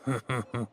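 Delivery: short chuckle at his own joke; brief amused laugh; hearty laugh. Acted. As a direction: laugh at the punchline you just told.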